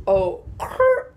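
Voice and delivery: slow "okay" with an attempted R trill; attempted rolled R. Vlogger still trying to roll her R's, failed trill. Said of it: "I can't roll my R's".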